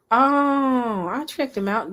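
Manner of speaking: ordinary delivery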